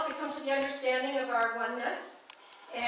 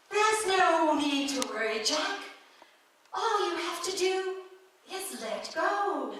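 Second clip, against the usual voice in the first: high, slow British voice from above; British female voice; 6 semitones higher, slower than usual. Acted. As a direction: reassure him as a British heavenly lady. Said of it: "it has a British accent".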